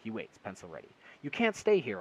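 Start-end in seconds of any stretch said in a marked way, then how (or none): 1.2-2.0 loud, high shout. A narrator's reading. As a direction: urge him to leave, yelling. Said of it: "I shout".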